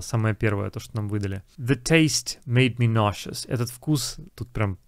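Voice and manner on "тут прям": ordinary delivery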